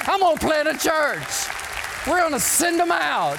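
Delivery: high, loud pulpit preaching; preaching at full force. Acted. Pastor preaching to his church, high and loud. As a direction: drive it home like a revival preacher.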